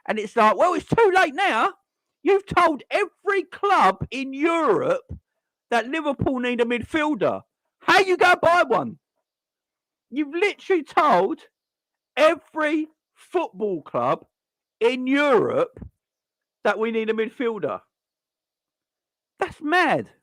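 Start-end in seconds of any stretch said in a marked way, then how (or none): 0.6-9.0 much higher, incredulous, slowed on the middle line; exasperated retort. Retold or imitated. A fan answering Klopp's admission, exasperated and higher. 10.1-20.1 higher, slow, spelling it out; incredulous rant. Real, not acted. To the stream about Klopp, incredulous and higher. Ranting to chat.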